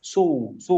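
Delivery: সু said with lips rounded and pushed forward; a heavy, round su; rounded সু demo. Shown as a demonstration. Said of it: "একটু গোল করে সামনের দিকে সু". (ঠোঁট গোল করে সামনের দিকে সু)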